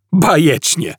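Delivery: high sarcastic burst; sarcastic blurt. A narrator's reading. Arthur to the guard, a high sarcastic blurt. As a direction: spit it out sarcastically. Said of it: "wyrzucił z siebie Artur".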